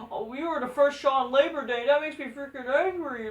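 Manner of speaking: high, sneering mock of Tony Khan. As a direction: parody his complaint in a high whine. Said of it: "This guy fucking said".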